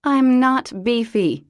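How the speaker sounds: loud, high, slow snap; snapped. A narrator's reading. Parker to James, a loud offended snap. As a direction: bite it off, offended. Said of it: "she snapped".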